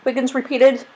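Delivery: ordinary delivery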